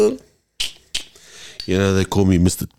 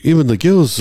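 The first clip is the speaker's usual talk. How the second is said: ordinary delivery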